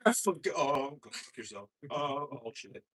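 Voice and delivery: usual speaking voice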